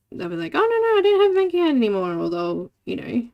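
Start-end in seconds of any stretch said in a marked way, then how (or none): 0.5-2.1 high, rushed, loud imitation of an excuse-maker; mock scammer excuse. Scammer dodging a request for bank details, mock flustered excuse. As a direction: gabble the excuse, pitched way up.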